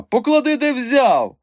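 very high, loud demand; protesting shout. A narrator's reading. Mikha dangling in his friend's grip, protesting shout. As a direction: squawk to be put back down. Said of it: "брикнувся в повітрі Міха".

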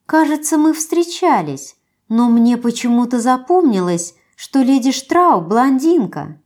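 sly pouty flirt. Acted. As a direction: needle her sweetly. Matilda needles the narrator.

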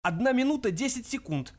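ordinary delivery, nothing marked

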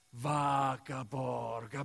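ordinary talk

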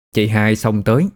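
ordinary delivery

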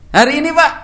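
put-on high, loud boy's reply; son answering. Son answering father, high and loud. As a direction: answer dad eagerly.

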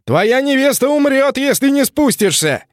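high shouted hostage threat; threatening shout. Acted. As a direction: yell the threat up at him.

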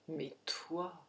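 low, soft and heavy; dejected low reproach; despairing. A narrator's reading. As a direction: let the words sink, hushed and hopeless. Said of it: "fit-il morne, désespéré".

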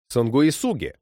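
ordinary delivery

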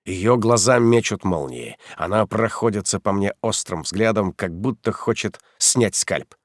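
ordinary delivery

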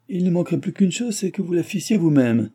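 high, scoffing sarcasm. Acted. Father mocking his daughter about cooking, high and sarcastic.

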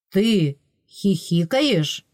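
slow, drawn-out accusing question; accusing prince. A narrator's reading. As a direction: draw it out like an accusation. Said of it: "обвинил в ужасном". (медленный обвиняющий вопрос)

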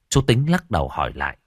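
ordinary delivery, nothing marked

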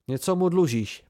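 sudden outraged protest; indignant blurt. A narrator's reading. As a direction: blurt it out, affronted. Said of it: "vyhrkl Sim a oči se mu leskly rozhořčením".

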